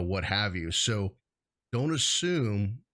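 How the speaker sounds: ordinary delivery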